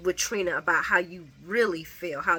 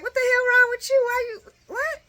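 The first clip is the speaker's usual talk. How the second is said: incredulous; 4 dB louder than usual. Pretend. Asking Curtis in disbelief.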